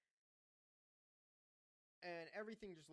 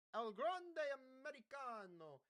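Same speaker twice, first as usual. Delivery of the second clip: drawn-out, higher-pitched mock Italian accent; 8 semitones higher, 4 dB louder, slower than usual. Host announcing the wrestler El Grande Americano, Italian accent. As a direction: roll it out slowly in a showy Italian accent. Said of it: "I do an Italian accent".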